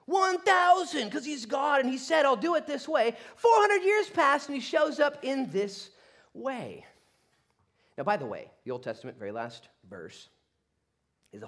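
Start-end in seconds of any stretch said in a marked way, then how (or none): 0.1-6.9 high, loud, emphatic proclamation; full-force preaching. Real, not acted. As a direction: shout it to the congregation with conviction.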